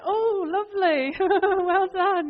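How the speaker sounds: high, loud, laughing fake delight; forced polite praise. Visitors fake-praise the uncle's odd plastic stepping stones, laughing and high. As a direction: gush politely through laughter. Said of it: "we're like, being polite".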